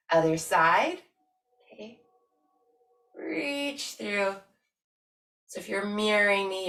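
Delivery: ordinary delivery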